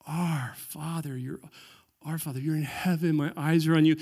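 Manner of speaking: hushed, tender devotion; soft sincere prayer. Shown as a demonstration. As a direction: pray it quietly as if to a close father. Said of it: "The power is in".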